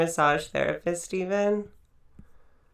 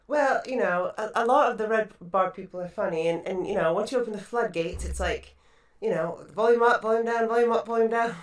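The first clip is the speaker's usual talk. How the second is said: attempted English accent, louder and slightly raised; Danielle; 4 semitones higher, 5 dB louder than usual. Host imitating Danielle on audio complaints, mock English accent. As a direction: put on an amateur English accent. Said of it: "I'm gonna try to do her accent".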